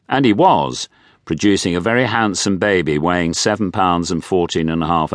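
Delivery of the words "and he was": plain reading voice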